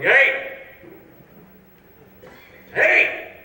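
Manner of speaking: high, loud calling out; yelling in hall. Acted. Guy in the hotel hall shouting to stop a man. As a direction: shout down the corridor.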